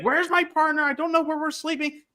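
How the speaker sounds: high, panicked mock whine; mock freak-out. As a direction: Nani in full freak-out mode, voiced high and frantic. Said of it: "Nani in full freak out mode".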